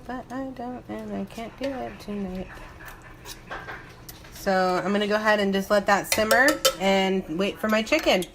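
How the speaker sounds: usual speaking voice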